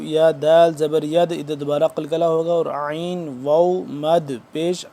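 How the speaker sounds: ordinary talk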